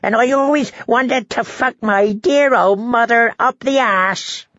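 very high, gleeful jester voice; high-pitched, lewd glee; cackling fool. A narrator's reading. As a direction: crow it like a mad fool.

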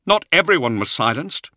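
loud, high-pitched objection; protesting. A narrator's reading. Zahariel objecting to Luther's accusation, protesting. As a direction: push back urgently. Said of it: "he protested".

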